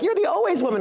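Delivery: high, thrilled recognition; excited fan. Retold or imitated. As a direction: squeal it like a starstruck fan. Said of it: "they're like".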